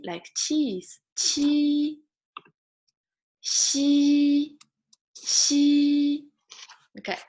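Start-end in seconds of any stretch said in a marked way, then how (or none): 1.2-6.2 "Qi" and "Xi" stretched on one high note; high level syllables. Shown as a demonstration. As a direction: hold each syllable high and even. Teacher models syllables to class.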